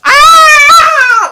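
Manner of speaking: very loud, high-pitched scream; long, piercing screech at full volume. Acted. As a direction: shriek it like a creature on the attack. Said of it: "she comes screeching towards you".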